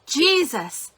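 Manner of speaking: retold panicked call, pitched high; panicked cry. Panicking mother calling on Jesus, high panicked cry. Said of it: "it started panicking, and I went".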